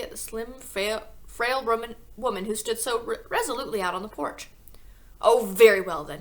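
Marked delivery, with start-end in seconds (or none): 5.2-6.2 loud, curt snap; snapped. A narrator's reading. Father letting the teacher in, loud grudging snap. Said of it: "he snapped".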